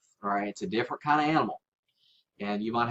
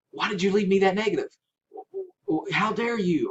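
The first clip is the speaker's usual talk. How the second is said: mock indignant, pitched higher and quick; abrasive confrontation; 8 semitones higher, 4 dB louder than usual. Shown as a demonstration. Seller confronting a buyer over a negative, mock indignant. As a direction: play the seller who snaps at the buyer. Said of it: "don't be abrasive whatsoever".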